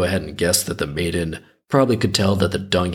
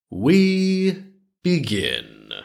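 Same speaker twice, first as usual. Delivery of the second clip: very drawn-out, higher announcement; storyteller's opening; 6 semitones higher, much slower than usual. Real, not acted. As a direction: open the tale with slow mock ceremony. Ceremonial opening of the tale.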